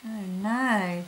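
normal talking voice

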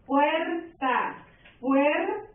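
"Puerta" split as "puer... ta"; syllable by syllable. Shown as a demonstration. Teacher letting students hear the stress, "Puerta" syllable by syllable. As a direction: draw out "puer", then a separate "ta" in "Puerta". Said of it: "¿Se escucha el sonido?".

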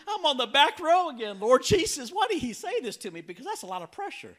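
very high, loud wail to God; hammed-up frantic complaint; comic panic. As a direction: squeal it in mock despair. Retold panic under pressure.

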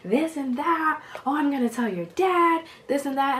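high, hammed-up motherly outrage; mom going off. Mom threatens to tell dad, high mock outrage. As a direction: carry on high like a scandalized mom. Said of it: "she starts going off".

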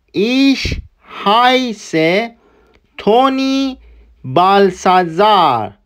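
very slow, carefully spelled-out German; slow teaching read. Shown as a demonstration. Teacher reads the dialogue slowly for students to repeat. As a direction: say each German word slowly and clearly for learners.

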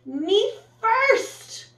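high, loud boastful voice; proud and puffed up. Acted. The limo boasting to the traffic, high proud voice. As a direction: puff up with pride. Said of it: "said the limo, all puffed with pride".

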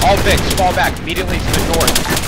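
loud, urgent order to retreat; urgent command. Real, not acted. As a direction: shout the order to fall back. Ordering retreat under contact.

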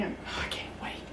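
excited little whisper. Real, not acted. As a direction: breathe it, eager. Excited aside while cooking.

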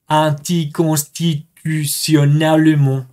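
very slow, syllable by syllable; deliberate, drawn-out pronunciation in French; slow careful French. Shown as a demonstration. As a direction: sound out the long French word carefully. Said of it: "no muy rápido".

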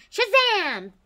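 high, loud cheering goodbye; excited sign-off. Real, not acted. The vlogger shouts goodbye to viewers, excited cheer. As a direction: shout the outro with energy.

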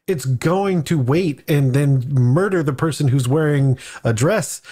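ordinary talk

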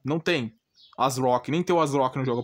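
usual speaking voice

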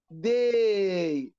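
داي as a slow, stretched "day"; long A in داي. Shown as a demonstration. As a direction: hold the "ay" of داي. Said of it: "أنا بمد في الإيه". (مدّ الإيه في «داي»)

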